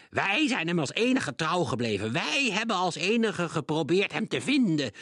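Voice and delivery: high, loud, proud defiance; defiant witch calling out. A narrator's reading. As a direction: hurl the prophecy at Krenk. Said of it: "keek Krenk aan en riep". (trotse, uitdagende heks)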